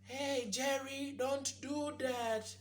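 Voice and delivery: high, drawn-out mock scolding; slow, high-pitched parent voice, ineffectual telling-off; mock European-style parent.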